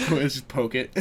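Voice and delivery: ordinary talk, nothing marked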